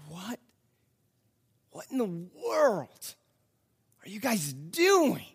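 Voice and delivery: slow, stretched-out bewilderment; Pilate's baffled disbelief. Acted. Pilate stunned by the crowd's choice, slow incredulity. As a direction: drag the words out in disbelief. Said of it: "imagine Pilate right here thinking".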